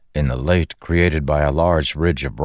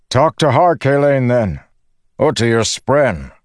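high, raised calling voice; shouting; 8 semitones higher, 4 dB louder than usual. A narrator's reading. Windrunner advising his companion in flight, shouting. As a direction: shout advice across to a fellow flyer. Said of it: "he shouted".

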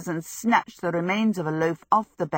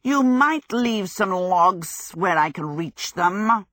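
slow, stretched creature voice; wheedling fire demon; 2 semitones higher, 4 dB louder, slower than usual. A narrator's reading. Calcifer asking Sophie for logs, wheedling demon. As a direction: draw out the request like a needy demon.

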